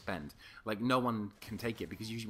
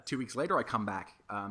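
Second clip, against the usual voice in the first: usual speaking voice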